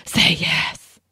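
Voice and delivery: unvoiced, urgent whispered plea; fervent whisper. Shown as a demonstration. Showing how the book's hero says it, fervent whisper. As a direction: whisper it fervently, all breath. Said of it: "That's a fervent whisper".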